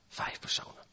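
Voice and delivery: hushed whisper for emphasis. Acted. Preacher to congregation, whispering for dramatic emphasis. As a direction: lean in and whisper the words.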